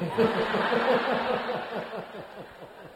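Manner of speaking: enjoy your own punchline; long laugh. Real, not acted. Writer laughing at his joke title for the audience, long laugh.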